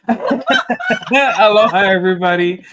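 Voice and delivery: drawn-out aloha through a real laugh; laughing greeting. Real, not acted. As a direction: laugh your way into the hello. Greeting after the mute-button joke.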